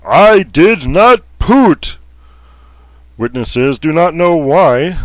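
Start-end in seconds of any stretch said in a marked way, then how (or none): 0.1-2.0 loud, slow, high indignant bellow; bellowed denial. Acted. Baron Puss bellows his denial to witnesses, loud and slow. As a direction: bellow it out like an offended aristocrat. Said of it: "bellowed out".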